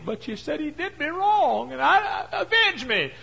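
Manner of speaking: high, loud sneering mimicry with a laugh; mocking the widow. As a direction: parrot her words back to mock her. Judge mocks the widow's words.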